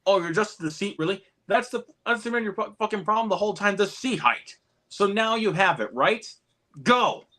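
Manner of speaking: high, mock-scolding band teacher; hammed-up impatient conductor; J K Simmons impression. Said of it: "J K Simmons delivers some of the best one-liners".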